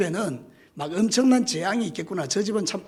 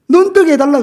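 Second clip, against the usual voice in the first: high, loud begging cry; loud plea; 9 semitones higher, 12 dB louder than usual. Acted. Preacher voices what the blind man would beg of Jesus, pleading loudly. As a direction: call it out as an urgent request.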